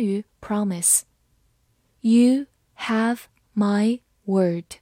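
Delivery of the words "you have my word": slow and clear; slow reading. Shown as a demonstration. Teacher reading slowly for students, slow and clear. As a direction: read it slowly for learners. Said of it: "slow repeat".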